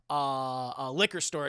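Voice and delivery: ordinary talk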